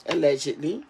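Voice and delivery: ordinary talk, nothing marked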